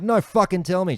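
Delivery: insistent anger. Retold or imitated. To Patricia begging him not to start, high heated insistence. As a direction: refuse to let it go.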